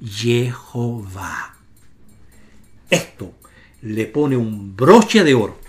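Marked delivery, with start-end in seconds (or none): 0.0-1.5 Jehová drawn out slowly, low and soft, starting on YE; slow, soft, lowered Jehová; syllable by syllable. Shown as a demonstration. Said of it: "la sílaba Ye del nombre divino".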